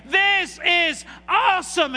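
high, loud, slow shout of joy; mock believer shouting victory; victorious shout. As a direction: shout it out in triumph. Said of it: "and you say".

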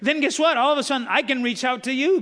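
loud, ringing preacher climax; full-force preaching. Acted. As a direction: drive it home to the congregation.

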